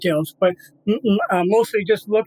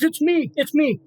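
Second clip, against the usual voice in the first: gentle dog voice; 7 semitones higher than usual. Retold or imitated. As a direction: say it high and gentle, reassuring. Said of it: "And he goes".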